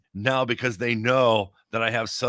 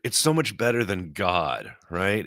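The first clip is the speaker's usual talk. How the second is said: ordinary talk, nothing marked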